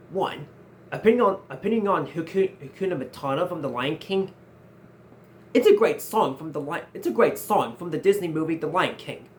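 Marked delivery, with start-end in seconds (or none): none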